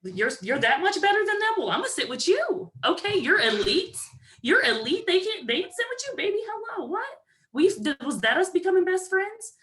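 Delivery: high, excited gushing best-friend voice; gushing bestie. Retold or imitated. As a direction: hype up the lonely girl in a squealing pitch.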